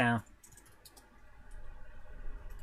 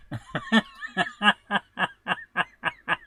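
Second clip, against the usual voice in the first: long, higher-pitched cackling laugh; real sustained laughter; amused laugh; 5 semitones higher than usual. Real, not acted. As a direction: burst out laughing at what you just read. Amused by the definition read out.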